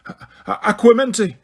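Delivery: stumble, then shout the spell. A narrator's reading. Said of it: "he shouted".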